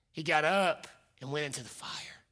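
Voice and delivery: soft whispered climax. Real, not acted. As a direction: let it land in a whisper. Climax of the point.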